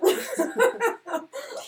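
laughing at her own odd joke; high giggle. Real, not acted. Laughing at herself for saying Russian, high giggle.

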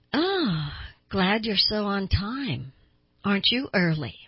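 slow, drawn-out mock delight; sarcastic lilt, stretched words; ironic sarcasm. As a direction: greet the latecomer with exaggerated irony. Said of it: "an ironic, sarcastic remark".

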